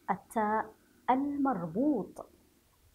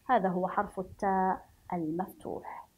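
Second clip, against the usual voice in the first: normal talking voice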